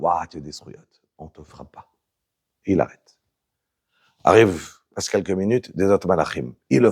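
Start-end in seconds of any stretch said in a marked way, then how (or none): 0.0-1.9 low, soft deep reply; angels answering. Acted. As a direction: drop low and calm for the angels. (les anges répondent, voix grave et douce)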